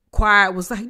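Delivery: normal talking voice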